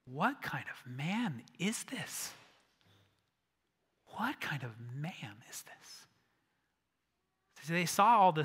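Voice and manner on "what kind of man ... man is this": slow, hushed whisper of awe; awed whisper. Retold or imitated. Disciples staring at each other after the calm, awed whisper. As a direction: whisper it in wonder. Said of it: "they go".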